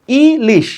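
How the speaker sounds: "Ilish" with a short break after ই; split into syllables. Shown as a demonstration. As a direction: separate ই from লিশ slightly. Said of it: "ইলিশ শব্দটাও কিন্তু দুই অক্ষরের". ("ইলিশ" অল্প থেমে দুই অক্ষরে, ই-লিশ)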